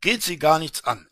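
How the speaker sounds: a loud, curt brush-off; brusque retort. Acted. Official brushes off the traveller's question, loud and curt. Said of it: "erwiderte der Amtsvorsteher".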